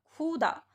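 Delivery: खुदा said as "khoo-da", long first vowel. Shown as a demonstration. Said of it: "बड़े ऊ की मात्रा लगा देते हैं". (खुदा को "खूदा" बोलें, लंबे ऊ से)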